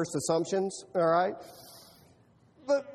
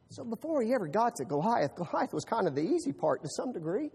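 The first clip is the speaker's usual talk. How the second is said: ordinary talk, nothing marked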